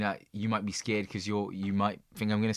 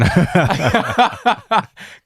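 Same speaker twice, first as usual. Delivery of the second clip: loud high laugh; 8 semitones higher, 12 dB louder than usual. Real, not acted. Laughing loudly at his friend's joke about his nickname, real loud laugh. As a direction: burst of loud laughter, pitched up.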